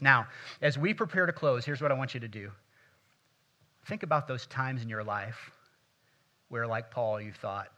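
ordinary talk, nothing marked

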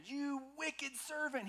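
high, drawn-out indignant rebuke; acted outrage, slow and high-pitched; angry master. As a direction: denounce the servant, stretching the words. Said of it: "In anger, his master".

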